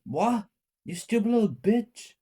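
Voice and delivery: high, loud, incredulous insult; high-pitched mother's angry outburst. A narrator's reading. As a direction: raise the pitch sharply as an outraged mother. Angry mother snapping at child.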